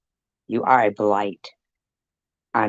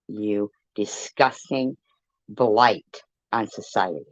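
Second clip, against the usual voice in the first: normal talking voice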